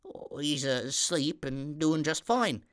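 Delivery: put-on slow, stuttering frightened boy; stammering kitchen boy. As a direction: stumble over every word in fright. Kitchen boy answers duck.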